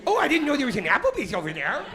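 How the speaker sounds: mock her like a bland suburbanite; mocking suburban wife. Comic mocking his wife's Applebee's remark, high chirpy voice. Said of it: "doesn't say shit like".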